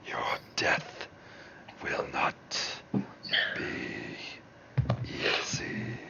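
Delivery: slow, breathy demon threat; demonic whisper. Acted.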